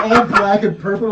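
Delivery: ordinary talk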